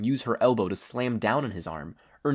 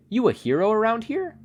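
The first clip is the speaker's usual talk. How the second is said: curious heroine; 12 semitones higher, 6 dB louder than usual. A narrator's reading. Kathleen questions stranger.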